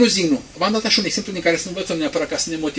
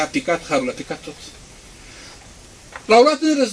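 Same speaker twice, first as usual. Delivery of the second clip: usual speaking voice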